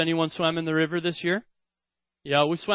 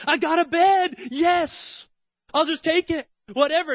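put-on much higher, thrilled child's cry; excited girl; 10 semitones higher than usual. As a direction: grab the gift with pure joy. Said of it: "she just says".

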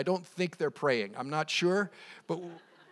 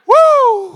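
high, loud "woo"; excited whoop; 19 dB louder than usual. Real, not acted. Pastor thrilled by the congregation's shout, excited whoop. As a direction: let out a pulpit whoop.

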